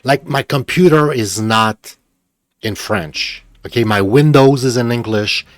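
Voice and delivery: a real fed-up tirade; angry rant. Real, not acted. Streamer ranting that his whole computer is in English, angry rant. Ranting about game being French.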